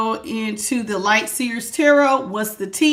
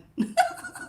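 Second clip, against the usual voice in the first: squeaky giggle; 6 dB quieter than usual. Real, not acted. Laughs at own joke.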